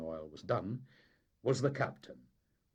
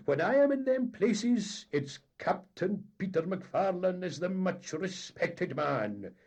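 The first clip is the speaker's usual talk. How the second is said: much higher, loud boasting; indignant captain; 8 semitones higher, 9 dB louder than usual. A narrator's reading. Captain lecturing the engineer, loud and indignant. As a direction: swell with wounded pride.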